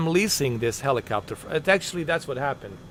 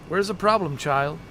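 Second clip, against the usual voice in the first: calling out; 8 semitones higher than usual. Real, not acted. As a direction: call her out across the distance. Calling out to companion.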